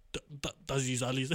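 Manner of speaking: put-on clumsy Russian accent in German; half-hearted Russian accent in German. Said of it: "ich kann so keinen russischen Akzent".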